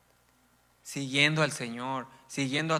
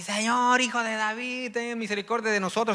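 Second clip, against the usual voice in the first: crying out; 7 semitones higher than usual. Retold or imitated. Two blind men crying out to the passing Lord. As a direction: call out to the Lord for mercy. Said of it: "ahí le gritaban así".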